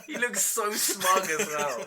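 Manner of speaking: high, loud, drawn out through laughter; laughing exasperation. Real, not acted. Host mocking co-host's smugness, laughing exasperation. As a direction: laugh helplessly while complaining.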